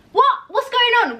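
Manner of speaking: high-pitched imitation of baffled shouting; mock shouting. Friends baffled by the film's sudden start, high-pitched mock shouting. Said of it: "We were shouting at the T V".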